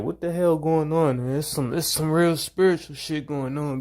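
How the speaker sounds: pitched well up, disbelieving outburst; what the hell is going on, high and wondering; amazed disbelief. Retold or imitated. At work, to himself, about sudden attention from women: high amazed disbelief. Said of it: "I'm like".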